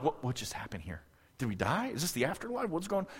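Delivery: acted bewildered whisper, very soft; stunned hush. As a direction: whisper as if shocked by sudden silence. Stunned disciples after sudden calm.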